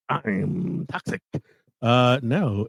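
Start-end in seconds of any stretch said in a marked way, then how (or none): 0.1-1.2 Pacino impression. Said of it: "Al Pacino".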